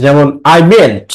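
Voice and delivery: ordinary delivery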